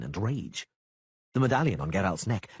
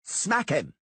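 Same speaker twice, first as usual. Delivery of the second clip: yelled; 7 semitones higher than usual. A narrator's reading. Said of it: "Sheepbagger yelled".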